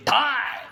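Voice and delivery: drawn-out bang noise; imitated gunshot. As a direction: voice a single gunshot. Spoken gunshot effect in comic.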